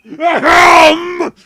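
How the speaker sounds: loud throat clear. Acted. Clears throat to get attention.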